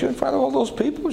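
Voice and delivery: high disbelieving question; incredulous echo. Retold or imitated. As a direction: toss her words back, amazed. Preacher's incredulous reply.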